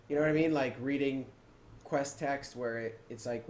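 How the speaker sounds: normal talking voice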